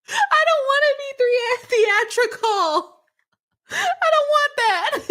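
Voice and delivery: very high, drawn-out comic whine; hammed-up high-pitched complaint; mock theatrical protest. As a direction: squeal it out in playful protest. Mock protest at being called theatrical.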